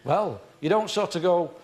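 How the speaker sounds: ordinary talk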